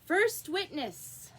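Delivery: high, loud, slow herald call. A narrator's reading. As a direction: announce the witness loudly. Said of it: "called out".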